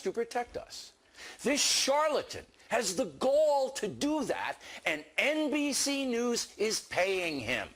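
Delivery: high-pitched, emphatic indignation; indignant outrage. Real, not acted. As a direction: let outrage lift the voice, hammering key words. Commentator outraged at a TV rival.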